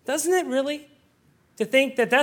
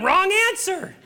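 blunt correction; 9 semitones higher than usual. Retold or imitated. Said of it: "And I said to them".

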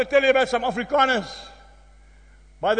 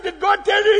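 loud and high, eager question; young farmer shouting back eagerly; excited shout; 8 semitones higher, 4 dB louder than usual. Retold or imitated. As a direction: call it out full of excitement. Excited shouted dialogue retold.

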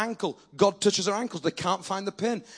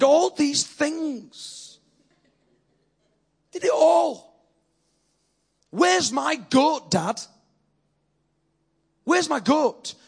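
put-on indignant older son; 6 semitones higher, much slower than usual. Older son complaining to his father, high and indignant. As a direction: whine it out like a wronged son. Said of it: "He says".

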